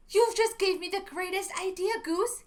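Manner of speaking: bright, loud, high excited quack; excited duck. A narrator's reading. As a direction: burst with the big idea. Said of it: "he quacked".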